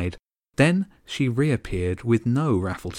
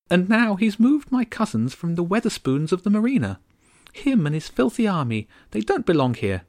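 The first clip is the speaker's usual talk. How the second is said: tiny haughty countess, aggrieved; high regal bird voice; 9 semitones higher than usual. Acted. Countess complaining about the gulls, high and bitter. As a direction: complain like an offended little queen.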